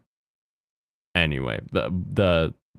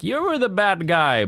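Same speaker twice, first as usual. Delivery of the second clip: mock accusation; 10 semitones higher than usual. Acting out a scene's accusation.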